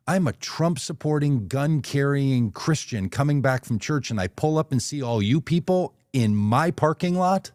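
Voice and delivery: half-acted fury, dragging out the proud self-description; retold angry rant. Owner telling the campaign crew to leave his lot, mock angry rant.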